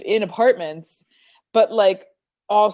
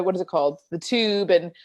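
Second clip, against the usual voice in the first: ordinary talk, nothing marked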